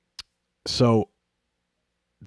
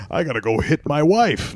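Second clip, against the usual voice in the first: mock outraged dad; 7 semitones higher, 5 dB louder than usual. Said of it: "imagining him".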